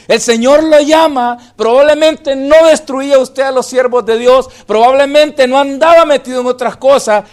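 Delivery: loud impassioned sermon voice; full-force pulpit preaching; fervent preaching. Acted. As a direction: preach it, pushing to the congregation.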